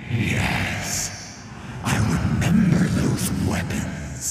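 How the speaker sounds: a sinister wraith breathing each word; cold hissing layered whisper. Acted. Thresh's voice.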